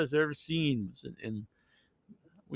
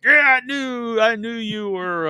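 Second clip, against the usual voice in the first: put-on excited old man; 7 semitones higher, slower than usual. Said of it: "He's like".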